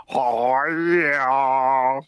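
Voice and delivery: low, drawn-out copycat oh yeah; a poor mimic's long, low holler; imitating co-host's catchphrase. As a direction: stretch it out, imitating your co-host. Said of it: "I'll do it like son".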